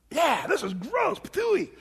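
put-on a kid's slow disgusted whine, spitting high; dad imitating his son spitting out bad juice; grossed-out boy. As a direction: play the little boy spitting it out. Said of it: "and he's like".

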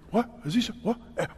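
lightly voiced confused pupils, hushed; puzzled kids. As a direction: murmur like puzzled kids shushing each other. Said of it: "the kids are like".

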